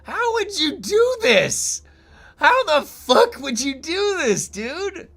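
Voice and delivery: high, slow, stunned disbelief; amazed. Real, not acted. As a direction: gasp it out in total awe. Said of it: "I saw that and I just had to say something".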